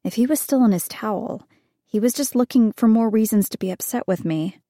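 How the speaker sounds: ordinary delivery, nothing marked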